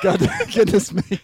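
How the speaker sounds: laughing, high and loud; laughing through the words. Real, not acted. Laughing exclamation.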